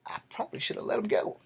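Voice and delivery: high, fast, breathy mock voice of a beaten guy; mock inner voice of the choked bully; imitating the bully's thought. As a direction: squeak out his regret. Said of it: "And he's thinking".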